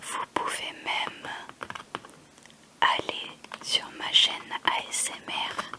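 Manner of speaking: soft unvoiced ASMR whisper. Real, not acted. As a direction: whisper close to the mic, ASMR style. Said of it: "On va faire un petit peu d'A S M R".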